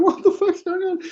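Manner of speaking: very high-pitched screamed disbelief; mock scream. Bystander shrieking at the five-point turn, high mock scream. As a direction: shriek it like an appalled onlooker. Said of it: "It's like screaming".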